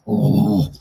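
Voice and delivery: a dopey clown's low "Oh". Acted. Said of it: "You hear the clown go".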